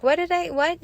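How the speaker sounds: imitating a toddler's high, puzzled chatter; little girl herself. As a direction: play a bubbly little kid caught off guard. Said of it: "she's back to herself again".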